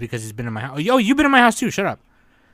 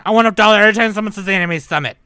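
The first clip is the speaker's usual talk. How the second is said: loud, fast, high greedy-executive voice; hammed-up corporate shakedown; mock corporate demand; 5 semitones higher, 8 dB louder than usual. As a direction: bark out demands like a greedy game company. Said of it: "Just go full Activision".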